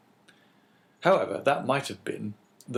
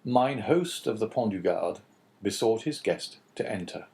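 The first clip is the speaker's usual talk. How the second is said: ordinary delivery, nothing marked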